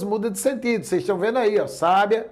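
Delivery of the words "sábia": sábia, stressed on the opening SÁ; stress on first syllable. Shown as a demonstration. Teacher showing students how accent changes a word, stress on first syllable. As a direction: hit SÁ in sábia, let the rest fall away. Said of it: "Se eu deslocar a sílaba tônica".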